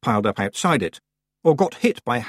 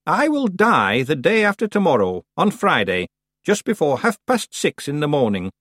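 put-on old witch voice; 6 semitones higher than usual. A narrator's reading. Witch announcing death.

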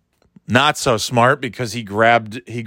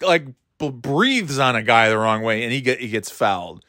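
ordinary talk, nothing marked

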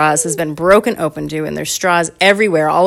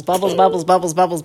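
usual speaking voice

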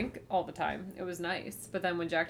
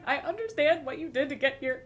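higher, lightly imitated crying voice; mock tearful; 7 semitones higher, 3 dB louder than usual. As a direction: imitate being overcome with emotion. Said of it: "crying. I was like".

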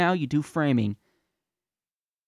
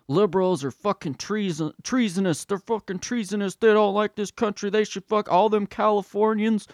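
mock angry patriot; 7 semitones higher, 3 dB louder than usual. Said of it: "then you have 'em being like".

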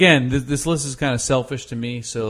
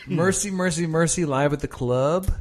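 ordinary talk, nothing marked

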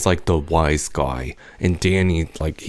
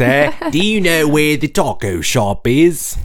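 slow, high mock British; hammed-up British, pitched well up; British accent; 8 semitones higher, 3 dB louder, slower than usual. Asked for a British sentence, high mock British accent. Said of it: "what do British people say".

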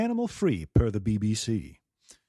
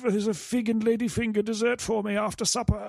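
pampered prince voice; 9 semitones higher than usual. Retold or imitated. Mocking the prince's childhood.

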